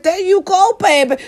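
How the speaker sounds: high singsong approval; cheering on. Retold or imitated. Approving the woman who follows, high cheering. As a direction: egg her on in a high voice.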